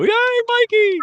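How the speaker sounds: high squealed cheer; mock cheer. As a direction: cheer like it's a huge win. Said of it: "so you can go".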